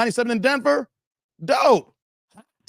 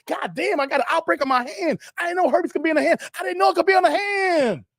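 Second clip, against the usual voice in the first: higher, rising alarmed voice, speeding up; mock-panicked single man in Houston; panicked guy; 8 semitones higher than usual. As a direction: act out a man shocked at his hand. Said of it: "It's like".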